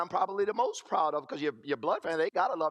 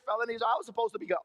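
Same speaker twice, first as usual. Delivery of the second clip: ordinary talk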